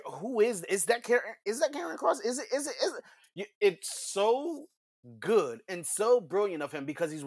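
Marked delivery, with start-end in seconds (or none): 0.0-3.0 high, stammering uncertain questions; hesitant announcer. Retold or imitated. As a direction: imitate a commentator unsure who he sees. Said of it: "doesn't want to say it just in case".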